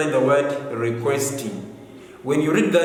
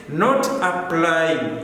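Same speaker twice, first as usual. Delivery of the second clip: "Not applying." said slowly, leaning on "Not"; slow stress on wrong form; much slower than usual. Shown as a demonstration. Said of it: "teacher marks the wrong form".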